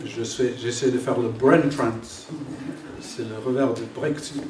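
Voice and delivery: English speaker's French accent; foreign accent in french. Real, not acted. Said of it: "pardon, mon français". (accent anglais en français)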